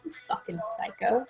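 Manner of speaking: very soft acted insult; quiet disbelief. Caller reacts to Richie's tirade, very quietly. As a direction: drop far down to insult him.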